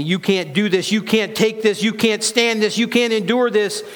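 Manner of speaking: insistent, high-pitched discouragement; taunting worldly voice. Acted. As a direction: drive each refusal home, pitch climbing. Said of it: "The world's telling me".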